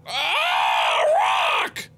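high scream of fright; startled scream. Real, not acted. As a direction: scream in sudden shock at what appeared. Startled by a rock.

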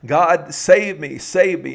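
high, loud plea for rescue; drawn-out storyteller's cry for help; crying out to God. Retold or imitated. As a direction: call up to heaven, slow and pleading. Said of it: "he cries out to God".

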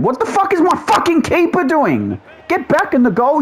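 high-pitched rant at the keeper; angry exasperation. Real, not acted. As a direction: berate your goalkeeper, pitch shooting up. Yells at his goalkeeper in frustration.

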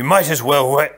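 loud start, broken off mid-word; voice cutting out. Acted. Prince on seeing the girl, voice dying mid-word. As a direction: stop dead as the voice fails. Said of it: "His voice quite simply died".

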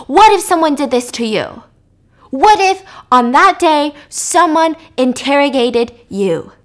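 a desperate, heated outburst at his boss; freaking out. Retold or imitated. Captain shouting back at his boss over the phone, upset. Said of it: "And he's freaking out".

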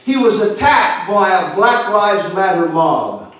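ordinary talk

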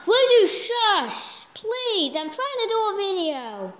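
high, drawn-out exasperated plea; exasperated shushing. Real, not acted. Child begging household to shush during video, exasperated whine.

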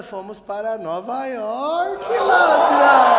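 drawn-out announcement climbing into a triumphant shout; building brag. Acted.

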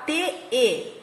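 ordinary delivery

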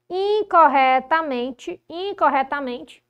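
INCORRETAMENTE drawn out slowly and loud; slow, forceful "incorretamente" for emphasis; emphatic repetition. Real, not acted. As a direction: hammer "incorretamente", stretching each syllable. Teacher hammers home the error.

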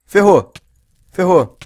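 high, loud alarmed cry; panicked yelp. Real, not acted. Player setting himself on fire, panicked yelp. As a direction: panic at your own mistake.

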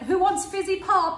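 high, slow mock offer; mimicking her friend. Friend mimicking Geordie pal offering drinks, high-pitched. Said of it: "You're like".

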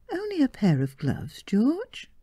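coy bride. Acted. Marion coyly confessing her bet.

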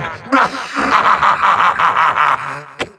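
staccato villain cackle; gloating ha-ha-ha, rolled out; evil laugh. Acted. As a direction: laugh like a triumphant overlord. Villain gloats over his minion.

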